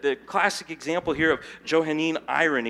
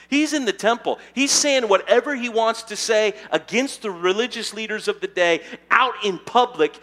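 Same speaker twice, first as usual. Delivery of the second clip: loud, driving sermon voice; full-force preaching; 3 semitones higher, 6 dB louder than usual. Real, not acted. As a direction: preach it out hard to the room.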